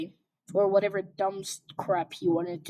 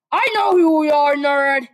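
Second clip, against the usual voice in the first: loud, higher snarling jab; angry hiss; 6 semitones higher, 9 dB louder than usual. Acted. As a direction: spit the insult out. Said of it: "Katsuki hissed".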